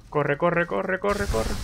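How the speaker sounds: rapid, high-pitched panic; frantic repeated cry to flee; panicked urging. Real, not acted. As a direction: urge yourself to run, voice climbing. Urging character to flee danger.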